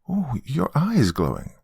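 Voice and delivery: put-on high child voice full of wonder; little girl. Daughter noticing uncle's glowing eye, little-girl wonder. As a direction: marvel as a small girl.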